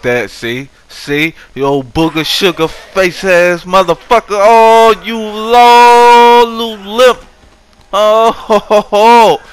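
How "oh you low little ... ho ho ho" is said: high, loud yelling at the zombie; panicked yelling. Real, not acted. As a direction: shout at the attacker in a panic.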